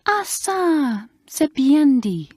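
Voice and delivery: high, slow French governess voice; a prim French mistress approving; French accent. Acted. French governess praising a pupil's remark, in a French accent. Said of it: "said Mademoiselle".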